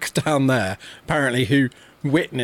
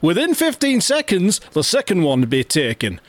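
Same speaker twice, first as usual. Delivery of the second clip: higher Geordie voice reading out a Facebook post; Geordie accent; 7 semitones higher than usual. Pretend. Podcast host reads a lake monster post to his co-host, in Geordie. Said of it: "Oh, I'm gone Geordie".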